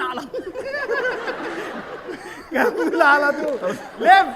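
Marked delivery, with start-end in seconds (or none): 0.3-2.5 laughing burst. Real, not acted.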